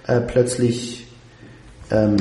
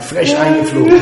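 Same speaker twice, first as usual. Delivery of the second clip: jingle voice; 7 dB louder than usual. Retold or imitated. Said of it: "so ein Hubschraubergeräusch am Anfang gemacht".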